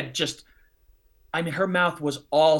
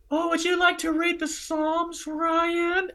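much higher, mocking sing-song imitation; mocking the professor; 14 semitones higher, slower than usual. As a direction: parrot him back in a high mocking voice. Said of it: "I was so mad".

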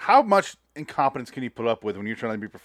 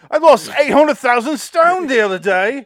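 much higher mock English voice, laughing; high hammed British bluster; mock Bale; 13 semitones higher, 10 dB louder than usual. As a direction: boast about weight like a pompous star. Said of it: "Bale parody".

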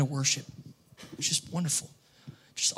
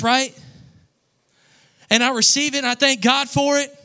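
rising preacher, high and loud; building preaching; 7 semitones higher, 4 dB louder than usual. Acted. Pastor telling the church about the prophecy, preaching at full force. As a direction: preach it harder and harder, higher and louder.

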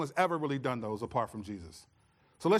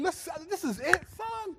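much higher, amused, laughing dad; proud dad. Retold or imitated. A proud dad laughs at his son crying over good grades, much higher. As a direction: tease the crying son with pride. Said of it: "I said".

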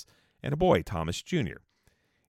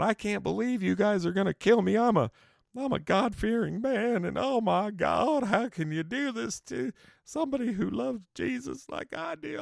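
high, pleading, mock-desperate voice; a high, wailing plea to the crowd from under the noose; pleading condemned man; 13 semitones higher than usual. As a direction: play a doomed man begging high and thin. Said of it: "talking and blabbing away".

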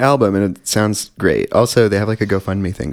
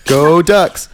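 loud cheer, much higher; shouting; 10 semitones higher, 7 dB louder than usual. Real, not acted. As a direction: yell it like a sports fan. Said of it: "Sorry for shouting".

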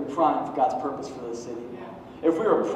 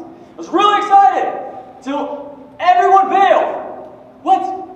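high, loud, slow mock complaint; hammed-up disbelief; mock indignant; 10 dB louder, slower than usual. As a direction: whine it like a let-down church planter. Church planter mock disappointment.

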